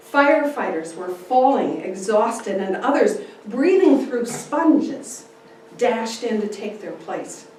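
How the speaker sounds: ordinary delivery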